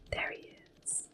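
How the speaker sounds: usual speaking voice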